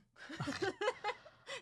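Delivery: a high burst of laughter. Real, not acted. Co-host laughs at the mayor's quoted text, high laughter.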